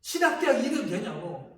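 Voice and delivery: reenacting his angry complaint to God; retold complaining rant. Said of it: "이렇게 불평하고 원망하고".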